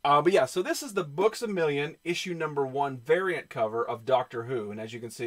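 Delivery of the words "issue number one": normal talking voice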